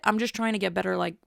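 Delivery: ordinary delivery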